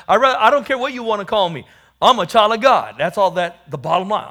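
loud, high, fast declaration; defiant proclamation. Acted. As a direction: shout it out proudly, no apologies.

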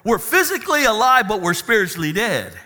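loud, high, fast preaching; rally the congregation about the new body; full-throated sermon climax; preaching at full force. Acted.

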